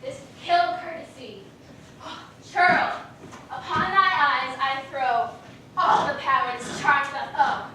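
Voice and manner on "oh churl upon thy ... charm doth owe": loud incantation. Acted. Puck casting the charm on the sleeping youth, loud incantation. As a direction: declaim the charm loudly over the sleeper.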